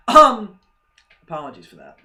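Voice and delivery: normal talking voice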